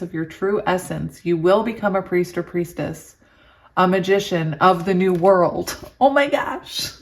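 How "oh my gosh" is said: high, delighted oh my gosh; excited gasp. Real, not acted. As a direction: squeal it with real joy. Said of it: "I don't know why I'm so excited".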